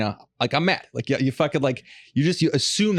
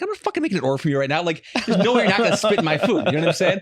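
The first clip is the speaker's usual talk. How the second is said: indignant rant; 7 semitones higher, faster than usual. Retold or imitated. Angry retold phone call.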